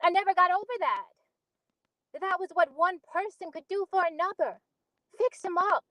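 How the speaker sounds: put-on earnest storyteller recalling a childhood accident; Beneatha monologue. Auditioning actor recalls Rufus's sledding accident, earnest dramatic monologue.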